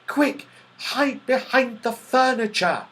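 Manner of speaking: plain reading voice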